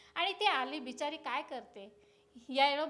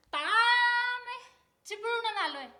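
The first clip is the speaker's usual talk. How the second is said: applicant with verbal tic; 6 semitones higher than usual. Acted. Applicant from Chiplun answering the director, stalling on a habitual filler. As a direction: stall on a stretched filler syllable before answering. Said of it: "ता म्हणजे ती एक आठवायची".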